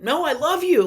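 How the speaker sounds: put-on bright emphatic reassurance to a child; parent reassuring child. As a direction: tell your upset kid you love them. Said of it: "I tell them".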